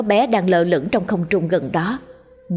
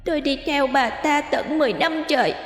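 put-on much higher, softer ghost-child voice building to rage; resentful ghost child; 9 semitones higher, 4 dB quieter than usual. Ghost child accusing its mother, bitter and growing angry. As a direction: spit out the grudge of an abandoned child spirit. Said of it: "Đứa bé càng nói, nó càng tức giận".

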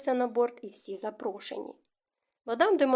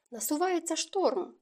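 higher, slow, lightly carefree tone; feigned unconcern; 6 semitones higher than usual. A narrator's reading. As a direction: mask worry with a touch of casualness. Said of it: "намагаючись за безтурботним тоном". (удавано безтурботно)

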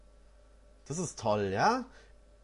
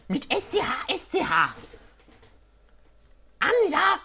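much higher, squeaky with a laugh breaking through; high gnome voice; 11 semitones higher, 4 dB louder than usual. Acted. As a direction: giggle through the old gnome's fussy words. Engewuck voice, laughing.

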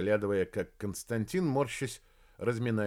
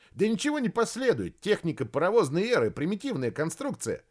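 excited protest; 7 semitones higher than usual. Acted. Kostya argues, rising excitement.

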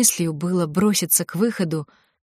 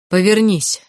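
louder, sudden command; cold, hoarse order; 3 semitones higher, 5 dB louder than usual. A narrator's reading. Prince ordering the girl behind her back, cold command. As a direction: order it coldly, with some force. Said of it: "ударил в спину холодный хриплый голос".

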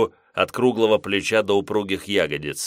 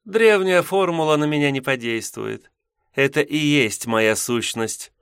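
high, self-assured succubus voice; seductive demoness; 7 semitones higher than usual. A narrator's reading. Succubus speaks.

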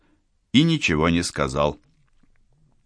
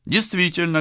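usual speaking voice

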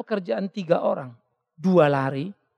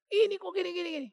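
exasperated; 12 semitones higher, 5 dB quieter than usual. Retold or imitated. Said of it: "Ada waktu saya emosi dalam pelayanan".

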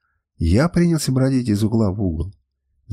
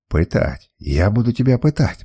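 ordinary delivery

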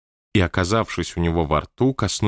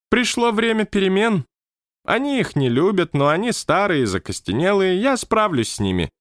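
lightly raised, stately manager; high manager voice; 12 semitones higher than usual. Acted. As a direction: speak as the odd high-voiced boss. Manager speaks. (высокий голос руководителя)

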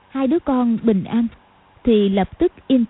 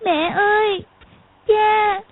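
put-on high, tearful little girl calling; crying child; 11 semitones higher, slower than usual. A narrator's reading. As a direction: call out for mom and dad, crying. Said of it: "chỉ khóc gọi".

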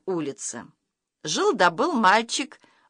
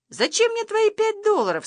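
very high cartoonish questioning voice; storyteller's high-pitched creature voice; talking camera. A narrator's reading. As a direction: squeak it out as a little talking camera.

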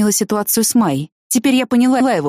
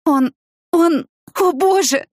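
высоко, медленно, с запинками; с трудом выдави слова, обрываясь на паузах; выдавливая слова; 10 semitones higher, slower than usual. A narrator's reading. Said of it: "выдавила из себя я". (сдавленно, в ужасе)

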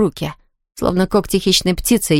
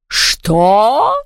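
outraged rising villain question; roared question; 2 semitones higher, 3 dB louder than usual. A narrator's reading. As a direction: stretch one word upward in fury. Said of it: "взревела Яспера".

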